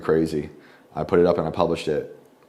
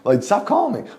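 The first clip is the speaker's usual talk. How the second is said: exasperated retort; 13 semitones higher than usual. Retold or imitated. Exasperated retort to crying friend.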